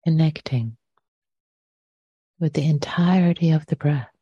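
unhurried meditation guidance with long pauses between phrases; guided meditation. Real, not acted. Teacher inviting listeners to settle into the body, guided meditation. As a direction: lead them gently into the breath, leaving lots of silence.

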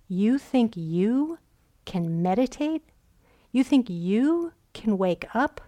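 slow, incredulous taunt with swooping rises; mock them with drawn-out disbelief; scornful self-doubt tone. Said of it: "that tone".

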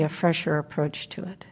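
soft whispered aside. Real, not acted. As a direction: whisper it gently, as if inward.

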